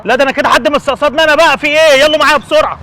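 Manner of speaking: loud, high frustrated outburst; furious rant. Acted. Father raging over the stolen money, loud. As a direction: rage that someone is targeting him.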